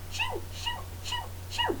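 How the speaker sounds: very high, soft shoo shoo. Shown as a demonstration. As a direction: shoo it away in a tiny high voice. Shooing in mouse voice.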